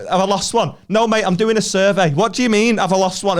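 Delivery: high, loud, exasperated retort; mock incredulous rant. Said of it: "I was like".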